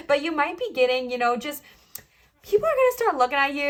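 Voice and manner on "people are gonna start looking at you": excited. Real, not acted. Excited build about others noticing.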